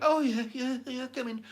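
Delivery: put-on high, eager old-folk voice; trusting old folks. To the fake gas man, a trusting pensioner, pitched high. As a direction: chirp it like a gullible pensioner. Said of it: "the old folks who don't know any better were like".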